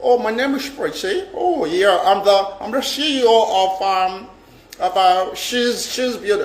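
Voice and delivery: lightly voiced affected lady; mock fake-posh caller. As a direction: imitate a fake, showy woman on the phone. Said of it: "all this fake, fake life".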